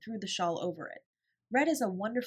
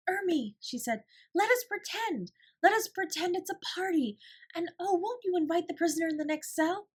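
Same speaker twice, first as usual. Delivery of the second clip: high, bright-eyed game proposal; eager pretend; 10 semitones higher, 4 dB louder than usual. A narrator's reading. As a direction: sweep her into the game. Sarah excitedly proposes pretend game.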